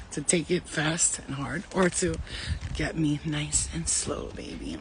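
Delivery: low, hushed, slow mock-seductive purr; jokey bedroom voice; trying to be sexy. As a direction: drop it soft and slow, playfully sultry. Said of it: "Me trying to be sexy".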